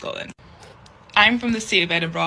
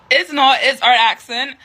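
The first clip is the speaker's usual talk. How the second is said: louder, higher, emphatic; louder, emphatic rebuttal; firm correction; 6 semitones higher, 7 dB louder than usual. Real, not acted. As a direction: shut the myth down.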